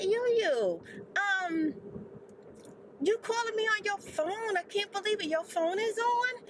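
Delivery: can't believe the phone works; mock astonishment; surprised grandma.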